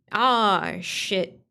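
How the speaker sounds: ordinary talk, nothing marked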